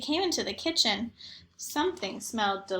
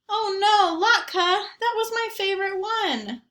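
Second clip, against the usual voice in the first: put-on higher, louder dismayed kid voice; dismayed girl; 8 semitones higher, 4 dB louder than usual. A narrator's reading. Zoe scolding Latka over her chewed dreidel, higher dismayed voice. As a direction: lament the ruined toy like a little girl.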